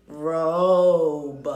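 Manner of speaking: Robe called out loud for the class; loud model word; saying it together. Shown as a demonstration. Teacher leading the class in saying robe. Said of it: "Say it with me, class".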